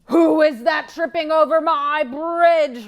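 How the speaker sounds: troll roaring at the big goat. Acted. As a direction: bellow from below. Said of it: "roared the troll".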